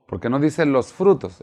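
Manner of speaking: ordinary talk, nothing marked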